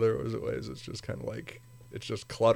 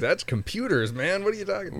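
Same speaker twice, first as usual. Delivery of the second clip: mock incredulous; 9 semitones higher than usual. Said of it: "It's like".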